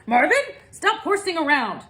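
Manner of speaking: high, loud exasperated call; scolding shout into the fog. Acted. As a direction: call out, fed up. Calling the moose in fog.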